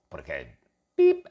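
ordinary delivery

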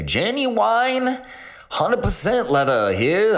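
high drawn-out Southern gentleman drawl, slow on the leather part; hammed-up old-time Southern shopkeeper twang; Southern gentleman accent. Southern seller out west hawking genuine leather, bad Southern accent. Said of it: "that was like a Southern gentleman from the twenties".

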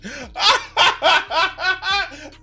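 high-pitched laughter. Real, not acted. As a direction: burst of high cackling laughter. Laughs at his own announcement.

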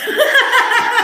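a loud, high cackle; high laugh. Real, not acted. Retelling the mids insult, cackling.

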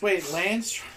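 normal talking voice

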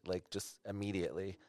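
ordinary talk, nothing marked

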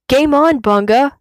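high, loud playful shout; cheerful shouted challenge; shouted back. A narrator's reading. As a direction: yell it back to a friend. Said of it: "Kion shouts back".

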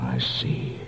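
a breathless, voiceless reaction to the gun; frightened whispered realisation; stunned hush. Acted. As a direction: let it fall out of you in a whisper. Sees the gun drawn.

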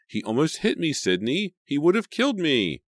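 high, loud, frightened cry. A narrator's reading. Emma telling Sydney Jason swung at her, crying. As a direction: cry it out still shaking. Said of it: "Emma cried".

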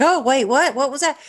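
surprised. Retold or imitated. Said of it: "you're like".

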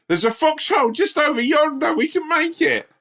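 very high, squeaky heroic order; mocking co-host's voice. Mocking his co-host leading the squad, very high squeak. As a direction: chirp it like a pint-sized war hero. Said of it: "And you'd be like".

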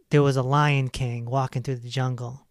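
usual speaking voice

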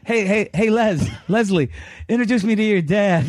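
high-pitched, insistent hailing; calling out for attention. Retold or imitated. Sparky to the friend with the older husband, high insistent calling.

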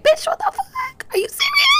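squeaky high exasperated outburst; mock outrage. Tired mom answering critics, high outraged squeal. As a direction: fling it out in disbelief, way up high. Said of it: "I get so mad when people are like".

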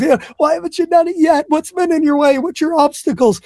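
pitched high, quizzing a student; high questioning voice. Retold or imitated. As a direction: ask it up high and pointed. Quoting his question to students.

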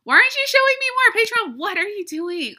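very high, loud exasperated wail. Real, not acted. Podcaster to Patreon page that won't show names, high loud exasperation. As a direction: whine loudly at a stubborn website.

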